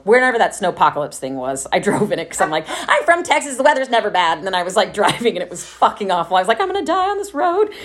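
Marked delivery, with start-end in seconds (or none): 2.7-4.4 high, loud self-mocking boast; mock overconfidence. Texan driving into a snowstorm, high mock bravado. Mocks her own naive confidence. 6.6-7.7 very high-pitched retold panic; mock panic. Driver stuck in awful snow, high mock panic. Imitates her panic while driving.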